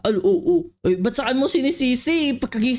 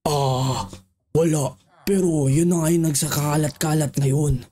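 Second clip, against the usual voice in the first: much lower, big voice; deep villager voice; 11 semitones lower than usual. Acted. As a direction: speak as a tiny villager with a booming low voice. Small villager deep voice continues.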